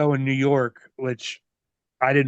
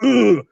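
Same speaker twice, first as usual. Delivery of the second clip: imitated grunt; 6 semitones higher, 8 dB louder than usual. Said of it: "He's like".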